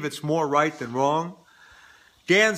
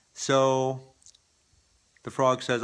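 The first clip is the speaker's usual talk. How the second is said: usual speaking voice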